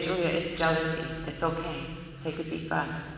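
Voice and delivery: calm her like a patient mentor; soothing sponsor. Retold or imitated.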